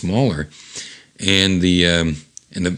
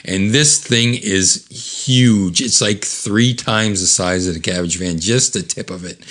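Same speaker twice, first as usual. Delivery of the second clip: ordinary delivery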